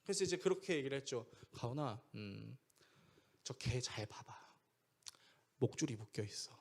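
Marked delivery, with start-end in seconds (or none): 1.5-6.5 low, soft, patient fatherly voice; calm reassurance. Retold or imitated. Father showing scared son the dog's leash, calm reassurance. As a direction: gently reassure a frightened child.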